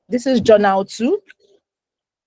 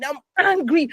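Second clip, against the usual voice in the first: normal talking voice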